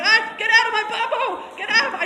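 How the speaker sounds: mock-shout at the horse; angry yell. Trainer demonstrating the wrong way, yelling at the horse. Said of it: "That's wrong".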